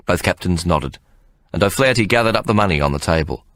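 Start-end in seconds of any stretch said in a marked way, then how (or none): none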